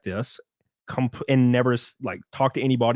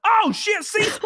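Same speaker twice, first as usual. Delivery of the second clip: sudden high-pitched yelp of recognition; retold surprise. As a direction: squeal his name in shocked surprise.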